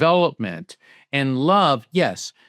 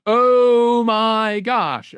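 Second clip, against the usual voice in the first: slow, loud, high oh my gosh; stunned alarm; 9 semitones higher, 4 dB louder, much slower than usual. Retold or imitated. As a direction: drag it out in shocked fear. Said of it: "She's like".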